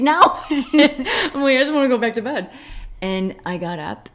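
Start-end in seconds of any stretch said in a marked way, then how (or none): 0.0-2.5 laughing while whining, higher and louder. Retold or imitated. Telling how she groaned at being woken, laughing. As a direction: giggle through the mock complaint. Retold sleepy complaint to the Lord.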